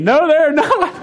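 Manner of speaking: high, laughing disbelief; boy's thought, high with laughter; incredulous kid. Retold or imitated. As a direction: squeak out the denial through a laugh.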